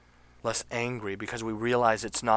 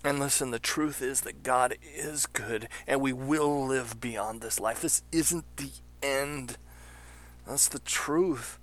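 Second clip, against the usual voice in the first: real emotion; 5 semitones higher than usual. Real, not acted. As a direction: hold it together while moved. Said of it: "I'm getting emotional".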